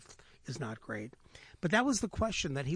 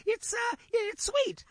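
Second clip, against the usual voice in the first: hyena fibbing in a much higher, louder voice; high giggling hyena voice; giggly hyena; 6 dB louder, slower than usual. Acted. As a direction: squeak the lie out like a nervous hyena. Hyena lies to lion.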